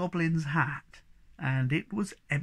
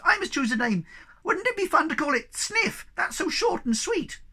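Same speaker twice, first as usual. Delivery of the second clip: squeaky; 14 semitones higher, 4 dB louder than usual. Acted. Sniff keeps squeaky voice.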